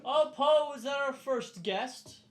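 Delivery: high, loud, drawn-out host greeting; feigned pleasant surprise. Acted. Host walking in on arriving guest, feigned delight. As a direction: sing out a welcome as if surprised.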